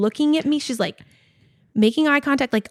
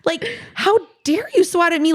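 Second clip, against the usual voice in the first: hammed-up outrage; offended; 4 semitones higher than usual. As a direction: scold the cat in disbelief.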